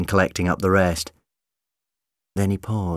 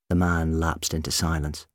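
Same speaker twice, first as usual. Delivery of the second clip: ordinary delivery, nothing marked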